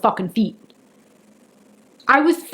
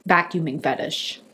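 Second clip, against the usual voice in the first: lower, softer, falling deadpan; flat disbelief; 7 semitones lower, 7 dB quieter than usual. Retold or imitated. Telling the audience, deadpan and quieter. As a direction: let it land with dry dismay. Letting it sink in.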